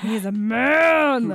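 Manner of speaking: loud, drawn-out declaration; dramatic announcement. Acted. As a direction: stretch it out big and loud. Dramatic announcement about a man.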